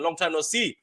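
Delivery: ordinary talk, nothing marked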